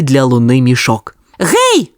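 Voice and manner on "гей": very high shout into the hole; calling out. A narrator's reading. Sonya calls into the dark hatch, high call. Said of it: "крикнула Соня".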